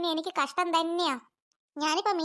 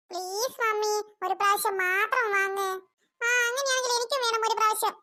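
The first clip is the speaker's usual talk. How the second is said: put-on pleading little kid; 5 semitones higher than usual. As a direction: coax mummy like a small child. Kids pleading with mother.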